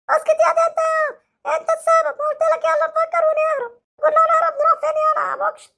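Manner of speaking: put-on hurt boy threatening the doctor with his dad; sulky hurt child. Same child.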